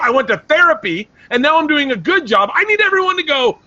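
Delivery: high, loud, worked-up protest; indignant rant. Real, not acted. Arguing with family over praise for growth, indignant. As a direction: plead your case at a high-pitched near-yell.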